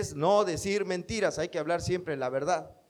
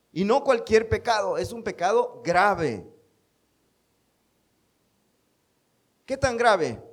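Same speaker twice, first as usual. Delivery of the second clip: emphatic preaching; 4 semitones higher, 5 dB louder than usual. Real, not acted. Preacher stressing grave sin.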